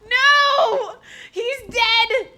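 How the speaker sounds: high loud scream with laughter; despairing laughing scream. Real, not acted. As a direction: shriek in mock horror, laughing. Character seems dead.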